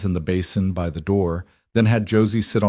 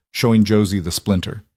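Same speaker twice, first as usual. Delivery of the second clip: plain reading voice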